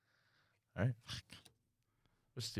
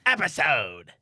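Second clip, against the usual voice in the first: announcer voice; 5 semitones higher, 7 dB louder, slower than usual. Acted. Hamming up podcast intro.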